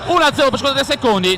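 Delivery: erupting goal commentary; breathless italian goal commentary. Real, not acted.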